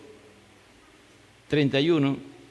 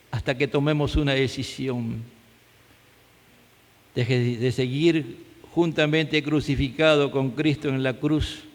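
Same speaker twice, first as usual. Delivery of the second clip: pastor's closing prayer; 3 semitones lower than usual. Real, not acted.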